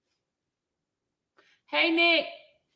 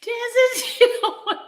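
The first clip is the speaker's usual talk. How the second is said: normal talking voice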